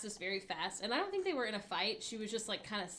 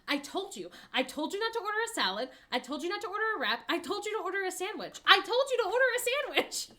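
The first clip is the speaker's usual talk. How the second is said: higher, louder, fast, building to a shout; exasperated rant; 10 semitones higher, 8 dB louder, faster than usual. Retold or imitated. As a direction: scold a friend, getting louder each time. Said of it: "the second one goes".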